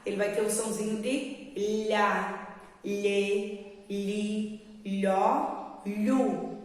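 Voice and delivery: "lhá, lhê, li, lhó, lhu" with the tongue rolled back; teacher drilling lh syllables. Shown as a demonstration. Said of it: "Ele enrola bem".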